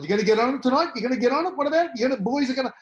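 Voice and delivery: a hammed-up cricket commentator goading the team to party; Shane Warne. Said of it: "he said to whoever the captain was".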